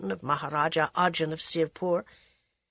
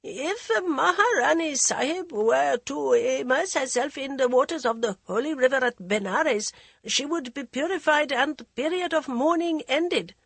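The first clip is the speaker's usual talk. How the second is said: high, thin hesitant minister; 8 semitones higher than usual. A narrator's reading. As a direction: speak thinly with a slight stutter. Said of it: "A thin voice, its slight stutter".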